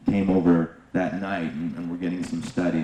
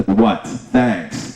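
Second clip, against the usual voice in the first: a touch of mocking arrogance, louder; arrogant curtness; 2 semitones higher, 6 dB louder than usual. Narrator mocking the agent's arrogant reply, snide. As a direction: mimic the rude neighbor, curt and snide. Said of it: "the arrogance".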